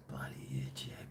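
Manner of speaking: whispered grumble under breath; whispered curse. Real, not acted. Grumbling about YouTube under his breath, whispered. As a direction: hiss it softly to yourself. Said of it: "muttered curse".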